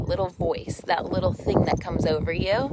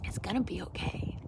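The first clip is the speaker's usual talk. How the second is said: soft reassurance; 11 dB quieter than usual. Retold or imitated. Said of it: "feeling that rush over you that goes".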